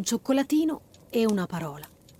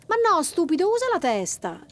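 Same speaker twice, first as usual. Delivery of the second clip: higher, louder sisterly scolding; bossy little sister, higher pitch; teasing scold; 8 semitones higher, 4 dB louder than usual. Acted. Girl scolds her brother.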